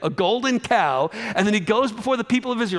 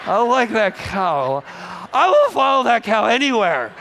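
hammed-up tipsy reveller building to a shout; drunk devotee; 3 semitones higher, 4 dB louder than usual. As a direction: swear loyalty to the cow like a happy drunk. Tipsy partygoers voiced.

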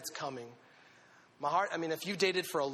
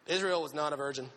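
normal talking voice

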